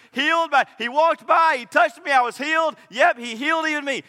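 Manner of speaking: a healed man boasting to the crowd, excited; eager testimony. Acted. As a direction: blurt out the healing story excitedly. Voicing healed man's eager testimony.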